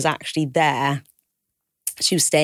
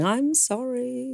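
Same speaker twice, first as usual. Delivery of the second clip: a slow, higher, softer mock sob; imitated crying; 9 semitones higher, 5 dB quieter, much slower than usual. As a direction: imitate the caller's wailing apology, drawn out. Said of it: "the same crying and going".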